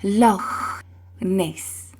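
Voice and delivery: Loch with a rough ch from the back of the throat; Loch Ness with throaty ch. Shown as a demonstration. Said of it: "how you pronounce the word Loch Ness".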